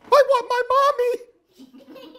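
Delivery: put-on baby owl crying for his mother. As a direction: wail it like a tiny owl wanting Mommy. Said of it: "cried Bill".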